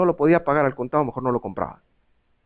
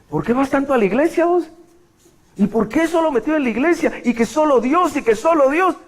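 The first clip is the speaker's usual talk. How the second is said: mocking critic; 10 semitones higher than usual.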